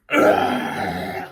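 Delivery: loud, low monster roar; imitated beast roar, low and explosive; bestial roar. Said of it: "like a bestial roar".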